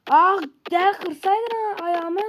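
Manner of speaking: slow, high, loud sarcasm; mocking the defender's foul; sarcastic outburst. Real, not acted. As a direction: drag it out mocking the tackle.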